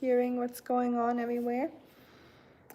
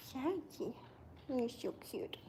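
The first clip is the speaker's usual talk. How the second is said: very soft, hushed cooing; tender, much softer voice; cooing to a pet; 2 semitones higher, 13 dB quieter than usual. Real, not acted. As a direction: murmur fondly to a sleepy pet.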